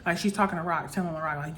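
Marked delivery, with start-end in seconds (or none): none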